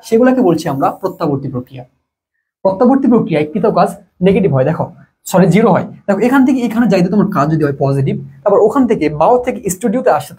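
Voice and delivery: ordinary talk, nothing marked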